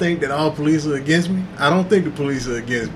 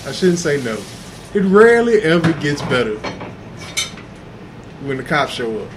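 normal talking voice

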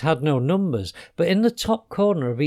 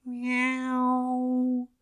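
very high, soft, drawn-out meow; tiny creature's faint high squeak; tiny tinny voice; 10 semitones higher, 5 dB quieter than usual. A narrator's reading. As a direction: mew thinly like a shrunken cat. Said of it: "in a tiny, tinny voice".

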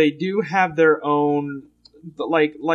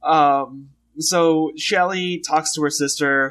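ordinary talk, nothing marked

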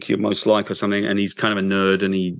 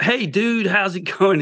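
much higher, chirpy surfer-dude turtle voice; cheery Ninja Turtle; 9 semitones higher than usual. Retold or imitated. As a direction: play the upbeat turtle buddy through the tears. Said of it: "He tries to be Ninja Turtles".

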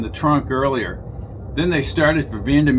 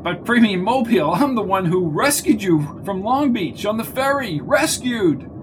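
higher, pleading indignant voice; desperate pleading; 8 semitones higher, 4 dB louder than usual. A narrator's reading. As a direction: beg to be remembered. Mundy protesting desperately.

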